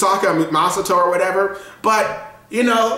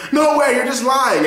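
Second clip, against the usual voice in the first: lightly voiced denial; 8 semitones higher than usual. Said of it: "he's like".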